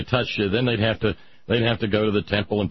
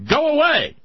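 high, loud booming dismissal; mock-imperious shout; Wizard of Oz; 6 dB louder than usual. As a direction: send them away in a big, commanding cry. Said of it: "The Willard of Oz says".

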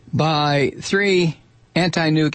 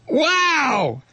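amazed; 11 semitones higher than usual. Real, not acted. Reacting to the breach.

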